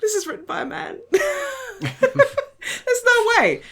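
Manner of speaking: high, laughing incredulity; squealing with laughter at the absurd plot; laughing disbelief. Retold or imitated. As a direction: crack up mid-protest, way up high. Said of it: "and I'm like".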